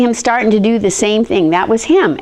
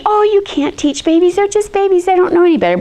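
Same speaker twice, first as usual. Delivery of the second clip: high, doting dismissive voice; mock the know-it-all relatives; dismissive adults; 7 semitones higher, faster than usual. People telling her babies can't learn, mock-doting.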